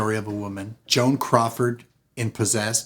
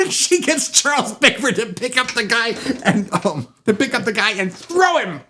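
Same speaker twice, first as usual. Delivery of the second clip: telling it through laughter; laughing; 11 semitones higher, 4 dB louder than usual. Real, not acted. Host laughing while describing the guest thrown out, laughing. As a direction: laugh as you tell it. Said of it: "laughing while telling".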